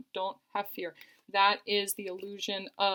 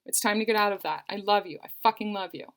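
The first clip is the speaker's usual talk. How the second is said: louder, fast, forceful orders; commanding and fired up; 4 dB louder, faster than usual. Retold or imitated. Reader voicing the partner ordering her past fear, loud and fast. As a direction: take the lead and push through the fear. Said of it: "stepping up and taking the lead".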